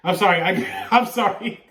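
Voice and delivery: can't hold back the laughter while saying sorry; laughing apology. Real, not acted. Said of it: "I'm laughing so much".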